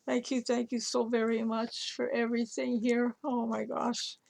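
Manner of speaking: real choked-up thanks, pitch lifted; overwhelmed and near crying, voice rising as she thanks her; thanking Susan for the gift, on the verge of tears; close to tears. Real, not acted. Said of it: "I'm gonna cry".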